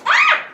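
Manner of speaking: sudden high, loud yelp; startled shriek. Real, not acted. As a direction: shriek in real alarm. Startled by flying yellow jacket.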